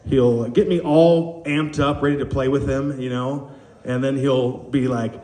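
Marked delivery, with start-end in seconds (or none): none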